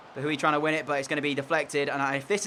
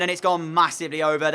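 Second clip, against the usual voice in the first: ordinary delivery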